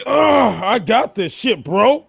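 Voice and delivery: high, offended macho protest; mock tough guy. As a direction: act wounded pride, hammed up. Mock defensive macho reply.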